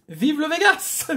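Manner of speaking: high, bright mock triumph; excited cheer. Cheers the scratch card.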